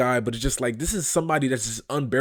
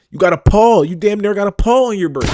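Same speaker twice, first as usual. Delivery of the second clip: raised, higher incredulous outburst; loud mocking disbelief; 5 semitones higher, 6 dB louder than usual. Real, not acted. Commentator calling out the toeless driver, loud mocking disbelief. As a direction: exclaim it loudly, appalled and mocking.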